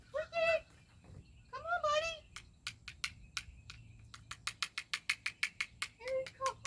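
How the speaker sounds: very high pet-talk voice; coaxing a pet. Real, not acted. Owner urging buddy to come running, high coaxing. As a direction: coax your pet along, high-pitched.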